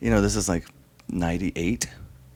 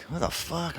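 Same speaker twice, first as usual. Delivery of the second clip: hushed confusion; 5 semitones higher, 12 dB quieter than usual. Retold or imitated. Waking in a strange place.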